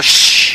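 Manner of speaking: loud sharp shush. Retold or imitated. Dad shushing the parents, a loud hiss. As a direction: shut them up with a hiss. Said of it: "and I go".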